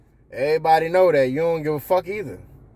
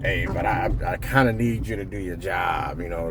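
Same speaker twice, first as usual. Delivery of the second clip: lower, softer and stumbling; fumbling awkward boss; 5 semitones lower, 4 dB quieter than usual. Pretend. Nervous new boss fumbling through feedback to a friend. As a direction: mumble it like you're dodging the point. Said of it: "like the first time I said it".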